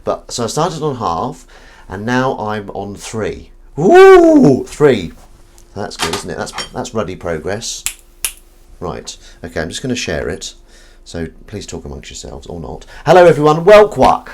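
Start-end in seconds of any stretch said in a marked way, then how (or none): 3.8-4.6 long, high, loud "woo"; loud, sustained cheer; celebratory whoop. Real, not acted. Celebrating reaching three pull-ups. 13.0-14.3 loud, high hello to all; big, high-pitched welcome; called-out greeting. Real, not acted. Calling greeting to the viewers.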